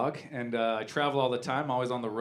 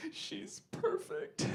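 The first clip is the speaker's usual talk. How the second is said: high, airy whisper, drawn out slowly; mock-overwhelmed new mother whispering; imitating tearful wife; 11 dB quieter, much slower than usual. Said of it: "My wife's crying".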